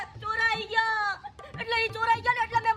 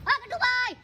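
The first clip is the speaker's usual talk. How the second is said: ordinary talk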